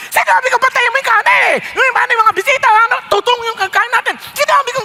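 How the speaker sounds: retold hostess yelling in the kitchen; angry yelling. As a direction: snap at the helpers about the burnt rice. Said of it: "galit na galit, sumisigaw".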